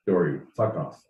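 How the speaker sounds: ordinary talk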